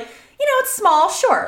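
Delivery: high-pitched, indulgent concession; agreeable parent. Retold or imitated. Mom allowing little Blanky at school, lightly indulgent. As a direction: pitch it up, weighing a child's request. Retold agreeable reply to son.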